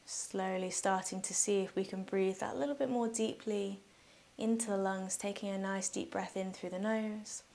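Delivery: soft, unhurried meditation voice with long pauses; guided meditation. Real, not acted. Yoga teacher guiding listeners through breathing, calm guided meditation.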